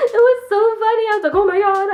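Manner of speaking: laughing through the words; laughing, high and loud. Real, not acted. Laughing while recalling slipping and falling.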